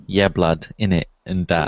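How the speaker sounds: loud London street slang voice; Ali G voice. Retold or imitated. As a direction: slip into a mock Ali G accent. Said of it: "I even talked like Ali G".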